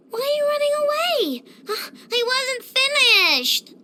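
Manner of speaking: high, whiny call after someone leaving, dropping at the end; dismayed wail. Acted. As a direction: wail after them as they run off. Calls after someone running away.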